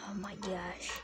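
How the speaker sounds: breathless hushed whisper. Real, not acted. Player spooked in the dark woods, whispered.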